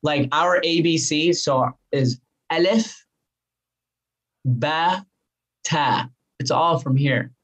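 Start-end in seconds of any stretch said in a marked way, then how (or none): 2.5-6.1 "alif, ba, ta" as separate slow Arabic letters; Arabic from the throat. Shown as a demonstration. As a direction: recite "alif, ba, ta" from the throat, pausing between. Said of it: "Arabic is from the throat".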